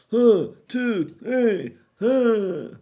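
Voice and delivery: a lightly done Bill Clinton, pitched high; mock Clinton counting off. Counting out loud, a light Bill Clinton impression. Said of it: "sound like Bill Clinton".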